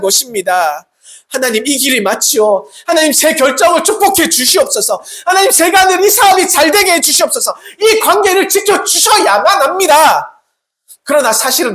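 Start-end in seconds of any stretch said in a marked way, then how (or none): 1.3-10.4 high-pitched, acted-out pleading; insistent prayer. Believer asking God to approve their own plans, insistent pleading. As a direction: press God with rising, insistent requests. Acts out self-willed prayer.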